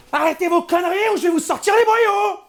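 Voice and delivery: high furious threat; angry shout. Acted. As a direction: scream the threat in rage. Yelling threats at the shooters.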